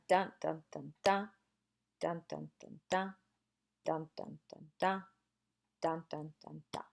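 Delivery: softly voiced metrical pattern; rhythmic dun-dun beat. Shown as a demonstration. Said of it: "you can see we've got a".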